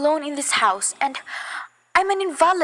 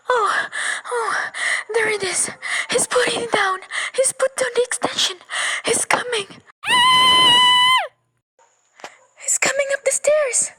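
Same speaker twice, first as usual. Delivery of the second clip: rising panic; 9 semitones higher than usual. Acted. Panic as killer climbs stairs.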